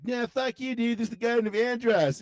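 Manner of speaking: high, mock-defiant cartoon voice; Cartman. Cartoon boy defying the old man, mock defiance. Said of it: "is Cartman and the old man".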